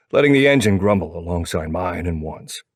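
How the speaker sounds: ordinary delivery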